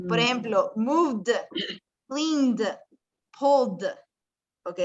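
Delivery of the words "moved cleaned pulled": "moved, cleaned, pulled" as moovd, cleend, poold; silent E, D ending. Shown as a demonstration. Teacher repeats examples, "moved, cleaned, pulled" with silent E and final D. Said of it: "la E es silenciosa".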